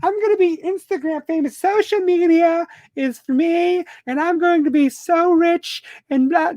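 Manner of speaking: high mocking voice of a wannabe influencer; high, silly voice of someone dreaming of fame; mocking fame-chaser. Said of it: "This isn't about me striking it rich".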